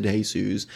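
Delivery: Jesus said the Spanish way, "hay-SOOS"; Jesus with an H sound at the start; Spanish pronunciation. Retold or imitated. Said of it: "you need to pronounce it Jesus".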